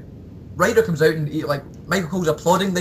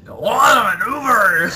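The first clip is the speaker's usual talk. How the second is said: mock Vince McMahon call; 4 semitones higher, 5 dB louder, slower than usual. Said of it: "Oh man".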